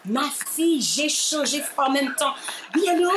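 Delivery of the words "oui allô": high metropolitan France phone voice; put-on France French accent. Taking the production's call, putting on a France French accent. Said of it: "je prends une voix de France".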